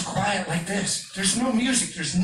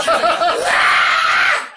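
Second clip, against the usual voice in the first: piercing, very loud high shriek; mom's fan scream; 13 semitones higher, 14 dB louder than usual. Retold or imitated. Comic imitating his mom screaming at [name], piercing shriek. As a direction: scream like a fan at a boy band. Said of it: "my mom is screaming like".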